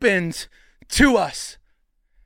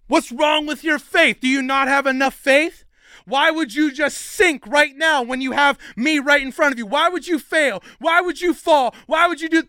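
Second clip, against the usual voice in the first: higher, mounting exasperated questions; mock scolding; 5 semitones higher than usual. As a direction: berate him in pretend indignation. Imagined Jesus scolding Peter.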